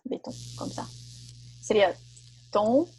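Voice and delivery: ordinary talk, nothing marked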